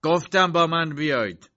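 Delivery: insistent yell; shouting. A narrator's reading. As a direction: shout it as an order. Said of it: "داد زد".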